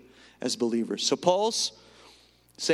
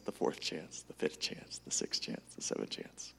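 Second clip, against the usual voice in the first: fading to a whisper; 11 semitones lower, 13 dB quieter than usual. Acted. Fading list.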